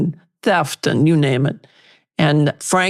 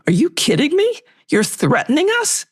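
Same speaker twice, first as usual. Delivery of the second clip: much higher, disbelieving challenge; incredulous retort; 11 semitones higher than usual. Retold or imitated. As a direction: throw it back at him in disbelief. Lawyer retorts to threatening agent.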